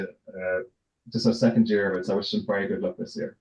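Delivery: usual speaking voice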